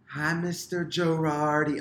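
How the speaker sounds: high, slow squeaky yelled greeting; like a cartoon voice on helium, called across the street; Harley Quinn helium voice. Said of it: "a mixture of Harley Quinn and my voice on helium".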